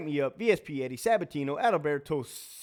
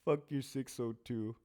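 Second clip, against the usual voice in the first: mock inmate taunt; 4 dB quieter than usual. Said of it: "Get my voice".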